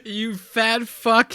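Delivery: high, slow mock of his insult; imitating the guy. Friend repeats the guy's insult, high and slow. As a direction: drag it out, laughing at the guy. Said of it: "he says".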